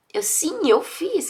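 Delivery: usual speaking voice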